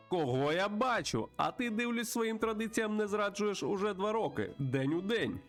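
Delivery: put-on high, lively voice greeting an old pal; chatty old friend. Plays a friendly bird character.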